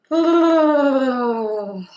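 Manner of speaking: long, loud exhale, sliding down; weary sigh. Real, not acted. Meditator letting out how overwhelmed she feels, weary loud sigh. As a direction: let out a big overwhelmed sigh. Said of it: "Just feels like, ugh".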